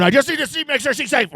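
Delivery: high, pleading mock dad; worried dad. Comic playing the anxious dad who needs his daughter safe, high pleading. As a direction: beg to see she's safe, half-joking. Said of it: "angry dad".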